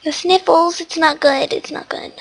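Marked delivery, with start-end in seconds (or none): 1.5-2.1 breathy whispered repeat. Real, not acted. Telling viewers she is ill, whispered.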